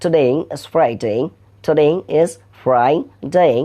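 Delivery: slow American "today is Friday", drawn-out vowels; American accent. Shown as a demonstration. Teacher shows students the American way, slow American accent. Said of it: "আমেরিকানরা বলছে".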